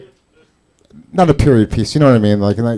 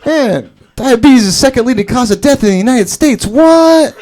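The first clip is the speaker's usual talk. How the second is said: high-pitched incredulous gangster; mock shocked disbelief; 11 semitones higher, 3 dB louder than usual. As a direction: build to a squealed, hammed-up question. Acted gang members' shocked reaction.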